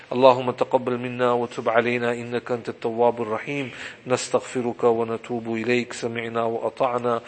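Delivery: fast, low Arabic dua recitation; closing supplication. Acted. Preacher closing the session to the congregation with a quick low Arabic dua.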